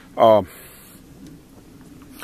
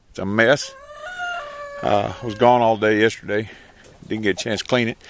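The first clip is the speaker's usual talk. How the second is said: normal talking voice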